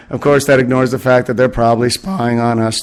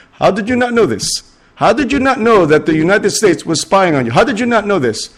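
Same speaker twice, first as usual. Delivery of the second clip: exasperated Israeli delegate; 7 semitones higher than usual. Retold or imitated. Israeli delegate scolding world leaders at a conference, hammed-up exasperation. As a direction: berate the room like a fed-up diplomat. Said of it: "an Israeli at some world conference just going".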